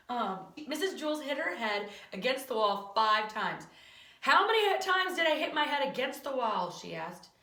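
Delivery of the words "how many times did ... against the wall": loud, raised-pitch exasperated question; exasperated demand. Acted. Teacher quizzes pupil after banging her head, exasperated. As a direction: demand an answer at the end of your patience.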